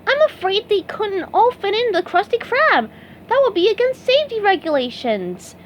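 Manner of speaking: high, eager cartoon sponge; sincere little sponge explaining rules; SpongeBob voice. A narrator's reading. SpongeBob answering the agent, high earnest voice.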